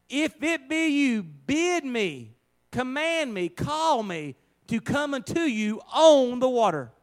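high, loud, slow repeated plea; Peter's plea again. Acted. Preacher repeating Peter's plea for the congregation, high and loud. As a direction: lean into each request. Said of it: "notice what Peter said".

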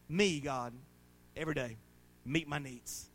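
earnest prayer spoken to God, steady and pleading. Acted. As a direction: pray it out as an example for the congregation. Model prayer spoken aloud.

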